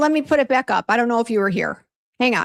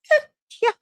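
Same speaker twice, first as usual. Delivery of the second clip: high, bright mock agreement; squeaky eh yeah. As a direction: chirp it up like you're going along with it. Mock-cheerful reply to stylist.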